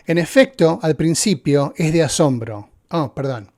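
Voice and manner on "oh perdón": ordinary talk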